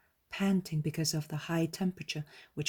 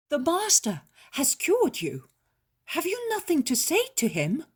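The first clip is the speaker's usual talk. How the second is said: mortified; 11 semitones higher, 6 dB louder than usual. A narrator's reading. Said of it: "Simon, mortified".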